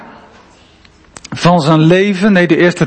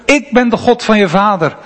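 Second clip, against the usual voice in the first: ordinary talk, nothing marked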